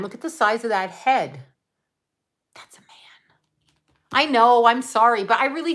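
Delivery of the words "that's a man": breathy secret whisper; whispered aside. Pretend. A conspiratorial whisper to viewers about a celebrity. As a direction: whisper it like a naughty confession.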